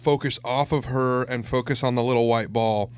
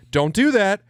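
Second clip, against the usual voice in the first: louder, higher retold parental command; mock stern scolding; 8 semitones higher, 5 dB louder than usual. Parent retells telling off the daughter, mock stern.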